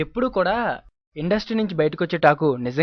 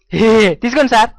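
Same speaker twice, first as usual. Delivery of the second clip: ordinary talk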